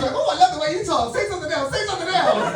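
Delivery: high, loud, mock-delighted pleading; imitating gushing fans. Americans begging the Englishman to talk, imitated in high gushing delight. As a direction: gush like a smitten admirer.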